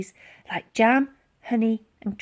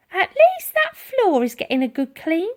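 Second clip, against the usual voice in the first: put-on old lady to herself; 9 semitones higher, 5 dB louder than usual. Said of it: "remarked Dotty to herself".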